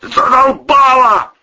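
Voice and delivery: slow, high, very loud outburst; barked. A narrator's reading. Man fed up with the girl's cries, barking. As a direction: bellow it out, fed up. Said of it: "рявкнул Протасов".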